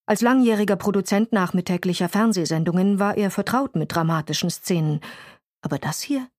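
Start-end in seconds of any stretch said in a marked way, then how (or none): none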